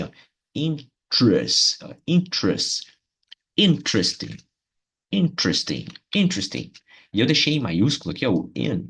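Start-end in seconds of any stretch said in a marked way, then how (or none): 0.5-3.0 "interest" slowly, IN high and strong, then "trest"; stress on IN. Shown as a demonstration. Teacher building the word for students, "interest" with IN stressed. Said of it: "Então vai ficar assim". 3.6-6.7 "interesting" with IN punched high, the rest falling; stress on IN. Shown as a demonstration. Teacher demonstrating "interesting" with strong first syllable. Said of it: "é a parte mais forte da palavra".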